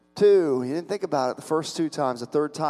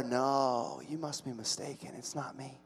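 very soft, shrinking denial; acted near-whisper of denial; hushed denial; 3 semitones lower, 14 dB quieter than usual. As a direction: barely voice it, backing away from the accusation. Acting Peter's subdued denial.

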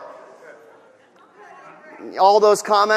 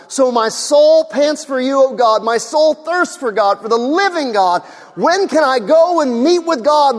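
higher, louder, fervent sermon voice; full-force preaching; 8 semitones higher, 6 dB louder than usual. Acted. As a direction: drive it home to the congregation.

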